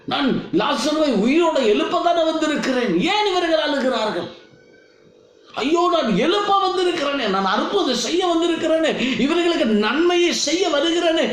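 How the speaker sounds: high-pitched, exasperated pleading as Jesus; dismayed protest. Acted. Jesus voiced facing weeping mourners at Lazarus's tomb, dismayed protest.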